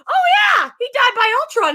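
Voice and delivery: high, loud, careless outburst; oblivious blurt. Retold or imitated. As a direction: blurt it out shrieky and tactless. Acting Geraldine blurting it out.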